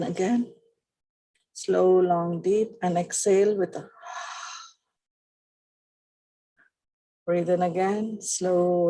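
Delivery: soft, slow body-scan meditation voice; guided relaxation. Acted. Leads a breathing relaxation.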